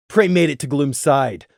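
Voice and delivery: ordinary delivery, nothing marked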